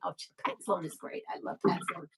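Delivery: soft whispered aside of admiration; whispered gush. Real, not acted. Whispering to viewers about a quilter she loves. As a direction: breathe it out like a fan's secret.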